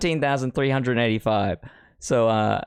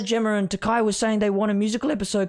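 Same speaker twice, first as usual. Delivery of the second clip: high, gushing fan voice, much higher; 10 semitones higher than usual. Retold or imitated. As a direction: squeal it like an excited fan tweeting. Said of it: "that's my fan voice".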